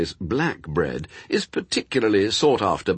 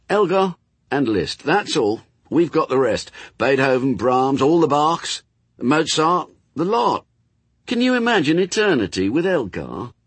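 high, emphatic list of composers; persuasive demon, pressing; 6 semitones higher, 4 dB louder than usual. Acted. Demon tempts angel with lost musicians, pressing. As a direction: reel off the names high and insistent. Demon's dialogue in narrated story.

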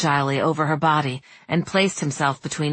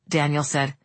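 ordinary delivery

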